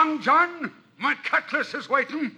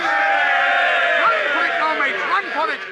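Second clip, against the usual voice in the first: shouting; 3 semitones higher, 7 dB louder, slower than usual. Acted. Urging men to the treasure.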